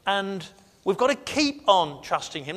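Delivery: ordinary talk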